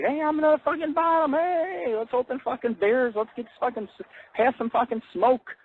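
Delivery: high, excited party whoop; giddy celebrating yell; whooping celebration. Pretend. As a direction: cheer like you made it to the bottom. Celebrating at the mine bottom.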